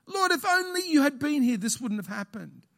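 high, pleading lament to the Lord; mock lament; Mary's complaint.

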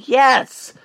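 high, loud self-hyping yell; screaming. Retold or imitated. Screaming at himself in front of onlookers, exultant yell. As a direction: yell it out to fire yourself up. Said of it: "I'm screaming at myself".

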